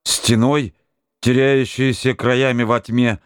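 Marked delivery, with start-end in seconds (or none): none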